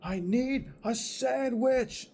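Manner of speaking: high, slow mock scream; imitating his screaming. Mocking roommate screaming from upstairs, high slow yell. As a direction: ham up the roommate's drawn-out yell. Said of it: "he's up there screaming like".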